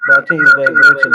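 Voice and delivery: loud, buzzing call audio; vibrating voice. Real, not acted. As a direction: voice buzzing with an echo over a bad line. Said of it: "My voice is vibrating".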